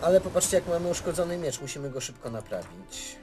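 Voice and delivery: ordinary talk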